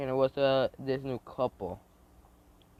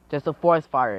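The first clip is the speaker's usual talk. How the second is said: raised, louder warning; barked alarm; 3 semitones higher, 8 dB louder than usual. Acted. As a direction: call out the alarm. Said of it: "the wolf barks, saying".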